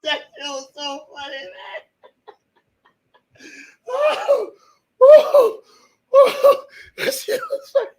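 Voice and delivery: real breathless laughter, squeaking high; collapsing with laughter; laughing. Real, not acted. Streamer telling chat about the letter, breathless laughing.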